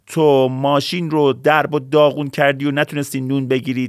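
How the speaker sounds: high, loud mother voice scolding the deer. Acted. Mother scolding the messy deer, loud and high. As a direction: tell the deer off like an angry mom.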